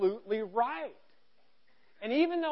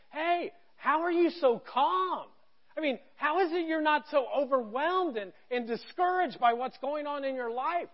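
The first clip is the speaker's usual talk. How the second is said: amazed question; 5 semitones higher, 5 dB louder than usual. Retold or imitated. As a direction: ask it like someone who can't believe how calm you are. Voicing people asking in amazement.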